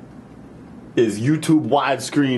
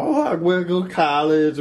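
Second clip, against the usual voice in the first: put-on girl's voice; 6 semitones higher than usual. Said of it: "she's saying all this stuff about".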